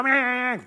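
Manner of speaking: high, loud animal bleat; mimicked sheep cry; imitated sheep bleat. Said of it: "And they go".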